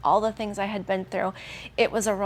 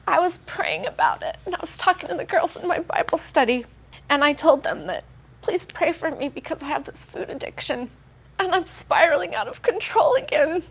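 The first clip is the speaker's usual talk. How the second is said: near tears; 7 semitones higher than usual. Real, not acted. Telling of her Bible study prayer, choked up and teary. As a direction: voice choked up, pitched higher with emotion. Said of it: "I'm gonna cry".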